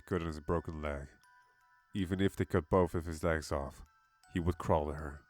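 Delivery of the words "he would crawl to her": ordinary delivery, nothing marked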